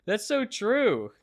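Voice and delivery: giggly and high, still laughing; bubbling over with amused praise; laughing delight. Real, not acted. Host praising co-host's comparison, laughing.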